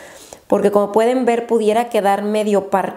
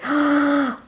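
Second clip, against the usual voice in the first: sharp gasp of delight; awed gasp; 4 semitones higher than usual. Real, not acted. Vlogger seeing highlighter swatches, delighted gasp. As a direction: gasp at something gorgeous.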